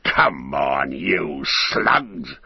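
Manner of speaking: coarse orc overseer voice; hectoring slave-driver voice; slave driver's cry. A narrator's reading. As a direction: cry out at lagging troops. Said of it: "he cried".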